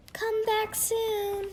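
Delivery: high, slow parting call; see him off kindly; fond farewell. Acted. Unicorns say goodbye to Kelp.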